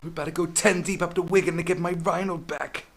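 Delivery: high, loud hype-man bellow; rally the crew like a hype DJ; over-the-top DJ rallying call; hyped DJ shout. Retold or imitated. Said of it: "He's like".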